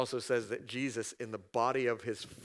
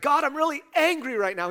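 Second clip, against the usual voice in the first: high, loud, exasperated outburst at God; venting anger straight to God; angry prayer; 13 semitones higher, 8 dB louder than usual. Retold or imitated. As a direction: let the frustration burst out. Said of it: "you're like".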